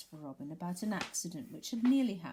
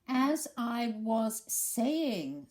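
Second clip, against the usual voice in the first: very slow, loud stern restart; 6 semitones higher, 8 dB louder, much slower than usual. Acted. Eeyore silencing interruptions, loud and stern. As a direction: reclaim the floor, stern and drawn out. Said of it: "said Eeyore loudly and sternly".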